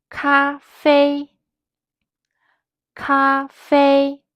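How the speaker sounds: every syllable held high and flat, from 出发 to 听说; first tone. Shown as a demonstration. Teacher to learners repeating after her, high flat first tones from 出发 to 听说. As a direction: say 出发, 咖啡, 发烧, 声音, 发声, 听说 on a high, level pitch. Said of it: "These all words use the first tone".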